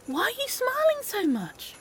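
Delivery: high-pitched, plaintive question; exasperated squeal without raising the volume; incredulous whine. Acted. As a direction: ask it high, in pained disbelief. Appalled at friend's happiness.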